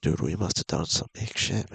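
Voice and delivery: ordinary delivery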